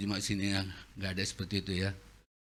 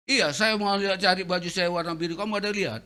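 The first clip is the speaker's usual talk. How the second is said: irritated father; 6 semitones higher, 5 dB louder than usual. Retold or imitated. Father asks about the shirt.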